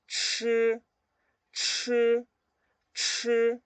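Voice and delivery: "chi" as retroflex ch with a puff of air. Shown as a demonstration. Teacher showing CH to learners, aspirated "chi". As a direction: push breath through a high, level "chi". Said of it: "con aire".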